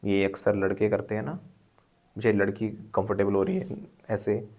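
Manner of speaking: normal talking voice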